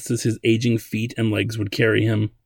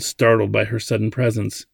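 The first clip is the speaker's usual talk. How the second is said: usual speaking voice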